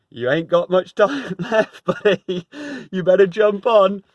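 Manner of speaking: a chuckling rescuer calling from the boat; gruff boatman. Retold or imitated. Second boat crew urging the stubborn man, laughing and loud.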